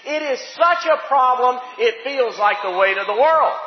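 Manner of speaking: loud, high-pitched preacher's cry; full-force preaching. Real, not acted.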